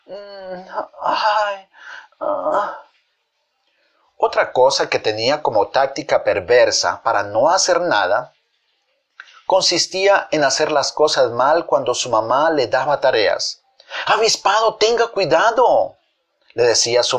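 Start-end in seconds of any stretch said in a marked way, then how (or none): 0.1-2.8 soft, high moans with pauses; put-on invalid moaning; feigned sick groans. 14.1-16.0 high, urgent motherly caution; acted alarmed scolding; mother's warning.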